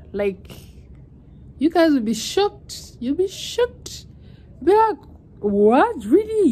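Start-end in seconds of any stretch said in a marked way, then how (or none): 1.6-6.5 high, loud, building excitement; excited. Real, not acted. Promising viewers a shocking story, excited high squeal. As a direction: squeal it like big news.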